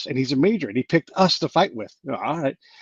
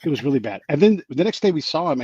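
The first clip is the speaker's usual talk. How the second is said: normal talking voice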